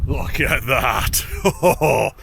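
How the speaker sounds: slow, drawn out joyful shout; excited shout. Real, not acted. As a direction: call it out in sheer delight. Summit view clears suddenly.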